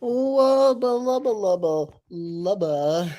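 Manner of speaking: loud, high, drawn-out nonsense chant; slow, belted silly cartoon voice; cartoon babbling. Streamer returns to the stream with silly babble, loud cartoon babbling.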